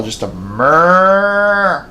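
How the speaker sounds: a slow mock moose call; imitated moose cow call. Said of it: "lets out a cow call".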